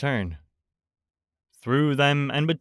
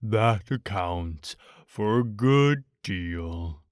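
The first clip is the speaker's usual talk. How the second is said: low, slow gloomy donkey; 4 semitones lower, slower than usual. A narrator's reading. Eeyore mournfully to Pooh, gloomy and low. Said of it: "said Eeyore gloomily".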